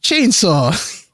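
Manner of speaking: mock commercial pitchman intro, dragging out the name; Jim Varney shtick. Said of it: "Jim Varney, uh, shtick".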